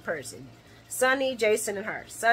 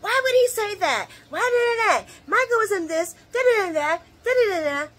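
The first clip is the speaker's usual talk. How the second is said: higher, whiny outraged fan voice; mock outraged fans; 12 semitones higher, 4 dB louder than usual. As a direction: play the upset viewers grumbling. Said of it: "everybody's gonna probably get up in a roar".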